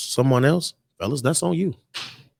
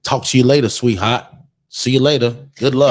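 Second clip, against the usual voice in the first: loud, punchy dismissal; mock angry brush-off; 11 dB louder than usual. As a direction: loudly imitate a partner cutting someone off. Same partner's sudden angry dismissal.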